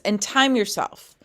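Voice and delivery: ordinary talk, nothing marked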